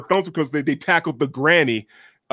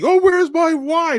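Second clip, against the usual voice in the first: mock husband; 13 semitones higher, 4 dB louder, slower than usual. Imagined husband today.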